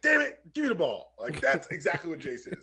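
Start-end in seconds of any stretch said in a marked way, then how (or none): 0.0-1.1 higher, louder mock curse; mock angry outburst. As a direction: blurt it like a fed-up player.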